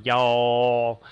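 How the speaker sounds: long Southern "y'all" with a moving vowel; Southern "y'all", the vowel stretched and sliding; Southern accent. Shown as a demonstration. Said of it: "they move around in that area up and down".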